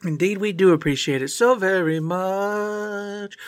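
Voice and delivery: slow, drawn-out singsong plea; hammed-up thanks. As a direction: stretch the words out, climbing very high.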